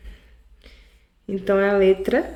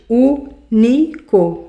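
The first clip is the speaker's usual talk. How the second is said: Único said very slowly and louder, stress on Ú; slow syllable demo; 4 dB louder, much slower than usual. Shown as a demonstration. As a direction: draw out Único to count syllables. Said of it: "Um, dois, três". (Único dito bem devagar, marcando Ú)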